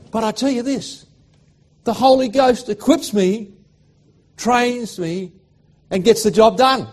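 high, loud preacher's build to a climax; slow, ringing pulpit proclamation; full-force preaching. Acted. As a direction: lift the congregation with rising fervour.

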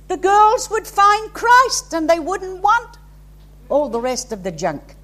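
impassioned preaching. Real, not acted. Preaching at full force.

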